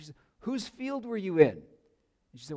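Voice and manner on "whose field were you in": eager question. Retold or imitated. Naomi asks eagerly.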